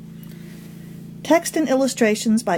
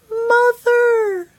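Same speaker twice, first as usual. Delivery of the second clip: high, squeaky cry for help; small trapped mouse crying mother; tiny mouse voice; 12 semitones higher, much slower than usual. A narrator's reading. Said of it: "came a faint mouse voice".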